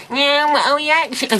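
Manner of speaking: high, sarcastic whine of an internet hater; mocking hater voice. Reviewer mocks the haters who will reply in a high sneer. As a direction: sneer it like a know-it-all commenter. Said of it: "a lot of hate people saying".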